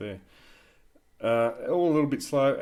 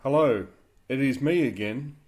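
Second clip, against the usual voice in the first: slow dictation with punctuation. Shown as a demonstration. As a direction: speak slowly and clearly for the software. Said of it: "I am trying to speak clearly and slowing it down".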